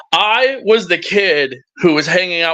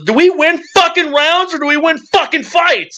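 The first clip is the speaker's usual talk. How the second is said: high-pitched, exasperated coach impression; scolding coach; 13 semitones higher than usual. Coach to his fighter at MMA practice, scolding. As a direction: imitate a coach demanding an answer.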